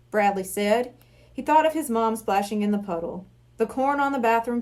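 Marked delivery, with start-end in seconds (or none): none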